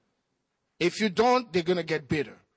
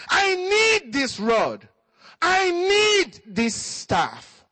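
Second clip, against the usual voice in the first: tearful cry; 9 semitones higher, 4 dB louder, much slower than usual. Real, not acted. Preacher's emotional climax.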